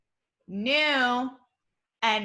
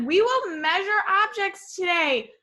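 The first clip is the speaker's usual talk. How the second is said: "We will measure objects today" pitched higher with a lift on "will"; excited reading; 6 semitones higher than usual. Shown as a demonstration. Teacher showing her class an exclamation-point sentence, excited. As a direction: read it out as exciting news. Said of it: "I'm excited about measuring".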